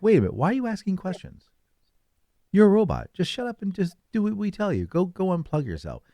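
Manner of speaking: high, fast scolding; scolding parent. Pretend. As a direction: snap at the robot. Family member scolds robot.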